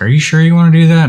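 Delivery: mock worried friend blurting it out; doubtful friends. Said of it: "people are like".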